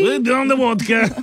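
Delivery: high, hammed-up Russian; mock Russian squeal; Russian accent. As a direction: play an excitable cartoon Russian. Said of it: "He's Russian".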